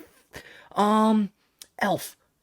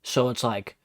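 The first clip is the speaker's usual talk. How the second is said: usual speaking voice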